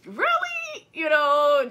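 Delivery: very high, mocking "Really?"; incredulous. The speaker reacting in disbelief to the pastor's sweet talk. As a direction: stretch it up in disbelief.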